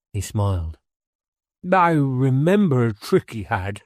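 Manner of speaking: lightly played old man smiling at a memory; warmly recalling a little brother; fond reminiscence. A narrator's reading. Thurgood recalls his brother.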